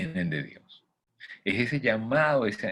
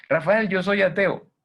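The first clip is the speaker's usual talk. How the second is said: higher, louder heckler voice; mock objector; 11 semitones higher, 7 dB louder than usual. Imagined atheist heckling the speaker, voiced higher and louder. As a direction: toss in a skeptic's protest. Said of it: "Es la voz de Dios".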